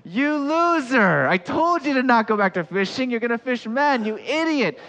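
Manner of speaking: loud, high mock berating; hypothetical angry shout. Jesus imagined shouting at failed Peter, mock furious shout. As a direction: bellow insults in hammed-up outrage. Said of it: "Jesus could have shouted at Peter".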